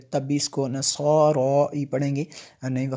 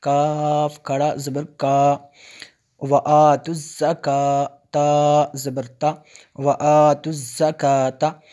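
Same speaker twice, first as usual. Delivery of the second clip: slow, drawn-out tajweed recitation, louder; Quran recitation; 5 dB louder, much slower than usual. Shown as a demonstration. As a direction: chant each syllable slowly like a Quran teacher. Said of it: "teacher reciting Quran letters". (धीमी, खिंची हुई तजवीद वाली तिलावत)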